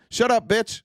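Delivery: sharp brush-off line; mock yell. Shown as a demonstration. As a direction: tell them to shut up. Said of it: "Just say that".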